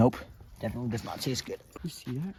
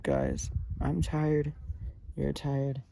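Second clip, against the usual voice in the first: ordinary delivery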